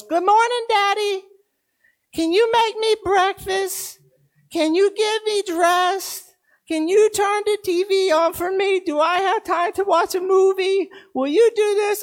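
put-on high, eager small-child voice; a toddler begging at the bedside; little boy voice. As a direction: voice the three-year-old asking his dad. Dad voicing his little son.

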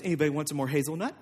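quick, low, soft aside; casual offer. Acted. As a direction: toss it off quietly to the table. Turns back to the guests casually.

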